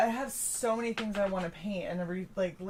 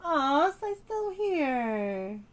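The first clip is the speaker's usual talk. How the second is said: cooing; 9 semitones higher, 4 dB louder, much slower than usual. Real, not acted. Cooing at a pet.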